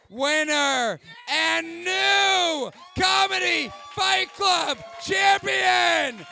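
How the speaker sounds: slow, stretched boxing-announcer call; hammed-up ring announcer crowning the winner. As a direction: belt it out like a fight-night MC.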